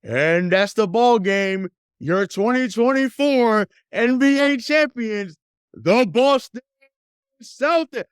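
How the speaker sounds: high, slow booming arena announcer calling the title; Eddie Palladino arena call. Retold or imitated. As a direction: stretch it out like the PA voice at the Garden. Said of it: "I wanna hear Eddie Palladino's voice saying".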